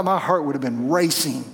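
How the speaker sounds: ordinary talk, nothing marked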